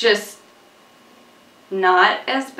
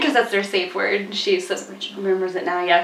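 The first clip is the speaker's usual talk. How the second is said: ordinary talk, nothing marked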